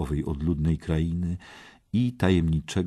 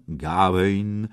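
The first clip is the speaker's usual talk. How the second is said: usual speaking voice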